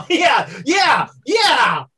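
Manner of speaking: loud laughing yeah; laughing shout. Real, not acted. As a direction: cheer it out through laughter. Laughing cheer at the table.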